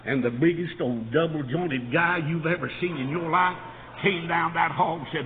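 ordinary talk, nothing marked

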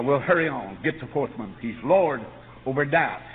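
ordinary talk, nothing marked

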